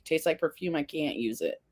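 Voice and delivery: ordinary delivery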